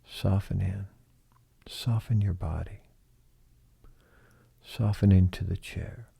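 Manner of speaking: gently coaxing, softened delivery; soft relaxation cue. Shown as a demonstration. Someone guiding a person to soften in, softly coaxing. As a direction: ease the words out softly, pausing between phrases. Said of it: "when someone says soften".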